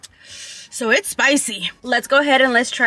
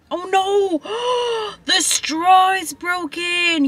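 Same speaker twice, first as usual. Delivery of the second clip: high, shocked oh no; gasping at a broken straw; dismayed surprise; 7 semitones higher, slower than usual. Real, not acted.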